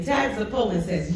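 usual speaking voice

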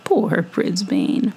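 plain reading voice

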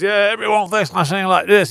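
high nose-pinched Australian impression. Singer answering a fan about his voice, pinched-nose impression. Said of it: "he's holding his nose".

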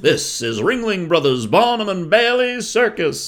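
ringmaster. Retold or imitated. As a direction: announce it to the whole big top. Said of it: "I could do the impression of it".

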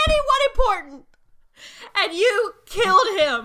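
very high, loud grief-stricken wail; high, trembly voice. Acted. Bellis accuses her familiar's killers, very high trembly cry. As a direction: build from one trembling word to a shrieked accusation. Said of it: "very high and, and trembly voice".

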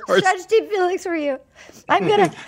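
very high mock crying voice; hammed-up tearful confession; Gabby sobbing. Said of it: "she goes to him and she's sobbing".

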